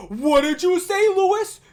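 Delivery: loud, high yelled challenge; top-of-lungs yell. Retold or imitated. Classmate yelling at Lewis across the classroom table, loud, high yell. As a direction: bellow it so it echoes down the hallway. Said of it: "This is what he yelled".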